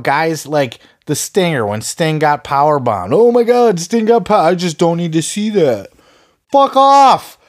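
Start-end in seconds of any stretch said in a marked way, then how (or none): 3.1-5.9 high, fretful mock-grandma gasp; mock worried grandma. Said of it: "becoming an old grandma or something". 6.5-7.4 high, loud dismissive shout; real exasperated yell, much higher and louder; angry shout. Real, not acted. Angry rebuttal to mocked fans.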